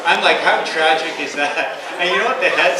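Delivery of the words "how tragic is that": mock pity.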